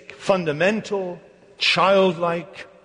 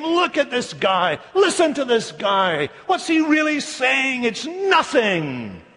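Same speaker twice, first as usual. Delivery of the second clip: high, stretched-out jeering; mocking ridicule; 8 semitones higher, slower than usual. Scoffers deriding Isaiah to one another, high mocking jeer. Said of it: "They were laughing at him".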